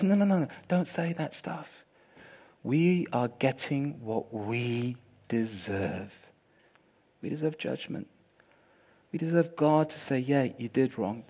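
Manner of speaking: soft, low, resigned voice; humble rebuke. Acted. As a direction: quietly accept the judgment. Repentant thief rebukes other.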